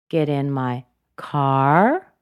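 slow, with "car" swooping up; unfinished upturn on "car"; rising intonation. Shown as a demonstration. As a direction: stretch and lift "car". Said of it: "Car is another one".

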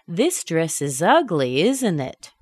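sure of agreement, drop ISN'T IT; falling tag question. Shown as a demonstration. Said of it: "The falling intonation shows".

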